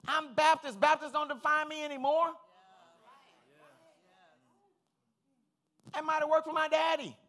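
loud, high preaching; full-force preaching. Real, not acted. Preacher to congregation, loud preaching. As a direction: hammer it home.

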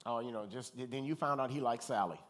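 usual speaking voice

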